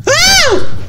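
high, loud yelp; short real cry of fright; startled yelp. Real, not acted. Player startled at the open door, startled yelp.

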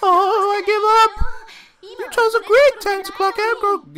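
high voiced woman, chiding; playful woman voice. Gamer dubbing Bulma's cutscene lines in high voice. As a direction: pitch way up to play Bulma.

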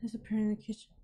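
ordinary delivery

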